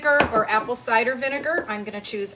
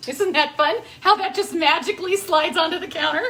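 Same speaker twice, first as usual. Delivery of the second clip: sarcastic; 5 semitones higher, 4 dB louder than usual. Real, not acted. Sarcastic about spill.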